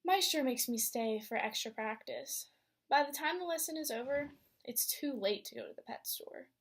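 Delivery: lively read-aloud storytelling voice; story narration. A narrator's reading. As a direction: read it to a class of young kids. Teacher reads picture book aloud.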